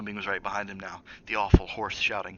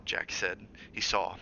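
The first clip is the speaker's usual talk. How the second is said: ordinary delivery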